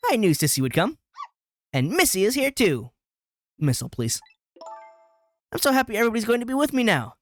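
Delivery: put-on a cheerful girl calmly welcoming doom; a hint of a dreamy young girl glad of company; lightly voiced girl, eerily cheerful. Voices Camila's dialogue.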